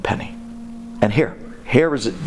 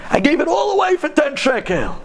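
begging; 13 semitones higher than usual. Acted. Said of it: "he's begging the guy".

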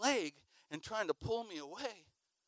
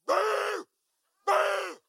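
loud, high mock bark; imitated dog bark; 7 dB louder than usual. Preacher imitating the dog behind him, loud high bark. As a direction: bark like a big chasing dog. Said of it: "The dog went".

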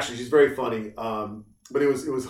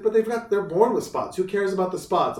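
higher imitated child; daughter's voice; 7 semitones higher than usual. Imitating his daughter defending the spotted dogs, high child protest. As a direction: protest like a puzzled kid. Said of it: "She's like".

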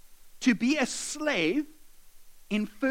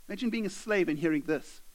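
usual speaking voice

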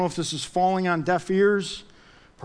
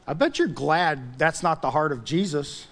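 normal talking voice

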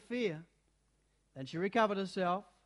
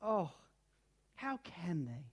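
tearful; 4 dB quieter than usual. A narrator's reading. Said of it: "tears streaming down her cheeks".

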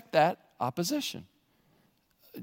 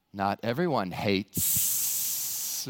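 mock snake-lover voice; 9 semitones lower, 6 dB quieter, much slower than usual.

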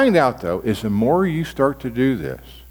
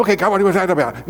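hurried, higher impatient question; mock impatient; 7 semitones higher, faster than usual. Pastor mimicking a hurried reader demanding answers from God. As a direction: rush it like you want the answer now. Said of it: "Don't rush it. Don't go".

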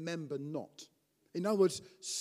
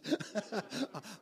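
soft laugh; 3 semitones higher, 5 dB quieter than usual. Real, not acted. Laughs at own joke.

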